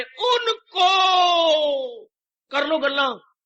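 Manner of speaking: high, loud cry of the word; preacher's sudden shout; emphatic shout. Real, not acted. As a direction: hurl it at the crowd.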